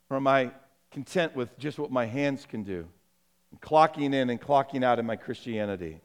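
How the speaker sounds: low, softened preacher's voice; hushed, measured pastoral appeal; earnest altar appeal. Acted. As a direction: bring the sermon down to a quiet, searching invitation. Sermon turns to a low appeal.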